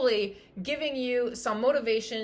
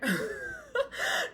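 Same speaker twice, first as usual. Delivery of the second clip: high burst of laughter; sudden high laugh; 13 semitones higher than usual. Real, not acted. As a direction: let a high laugh escape at your own joke.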